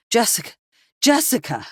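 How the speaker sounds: calling out. A narrator's reading. Husband calls out to wife.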